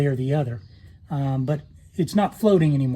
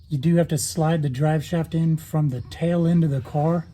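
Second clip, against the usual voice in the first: ordinary delivery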